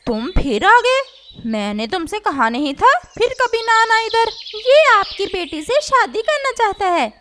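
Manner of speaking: mother chasing away the unwanted suitor; scolding mother. Acted. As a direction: tell him off at the door. Mother scolds returning crow.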